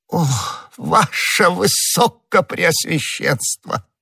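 high, drawn-out adoring voice; восторженный Бонасье. Acted. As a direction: swoon with servile gratitude. Bonacieux overwhelmed by cardinal's favour. (высокий, протяжный благоговейный восторг)

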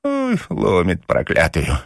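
injured papasha groaning. A narrator's reading. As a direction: moan over the aching head. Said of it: "и застонал".

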